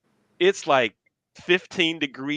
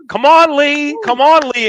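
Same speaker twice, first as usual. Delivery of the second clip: loud, high cheer from the stands; cheering mom; 9 semitones higher, 6 dB louder than usual. Retold or imitated. Mom cheers her kid on at the game, loud cheering. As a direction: call out encouragement like a proud parent.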